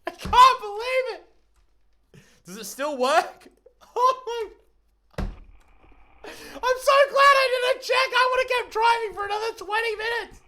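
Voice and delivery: ecstatic high shouting; excited screaming. Real, not acted. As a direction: yell it out in total disbelief. Still celebrating the crossing.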